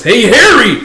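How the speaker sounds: high, loud angry call; acted outraged holler; furious shout. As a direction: yell to stop someone in their tracks. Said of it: "Shiguma getting furious".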